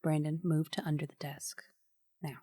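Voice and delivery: stern mom voice, low and soft; firm mom order to a child; aunt mom voice. Acted. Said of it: "I'm gonna use my aunt slash mom voice".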